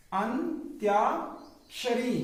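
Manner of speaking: अंत्याक्षरी stretched out very slowly; drawn-out spelling. Shown as a demonstration. Teacher spelling a word to class, very slow and drawn out. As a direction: drag out अंत्याक्षरी syllable by syllable. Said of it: "spelling it out slowly".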